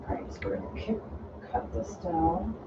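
drowsy, mumbled sleepy voice. Real, not acted. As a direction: let the sleepy voice creep in. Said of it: "And my sleepy voice is kicking in".

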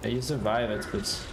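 ordinary talk

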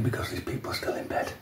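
hushed, dropping into a real whisper; soft low aside fading into whisper. Real, not acted. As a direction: keep it down for people still asleep. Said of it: "I'm whispering".

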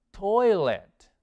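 TOILET said loud and on its own; emphatic model word. Shown as a demonstration. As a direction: present TOILET firmly for learners to copy. Teacher models the vocabulary word. (palabra modelo, fuerte y enfática)

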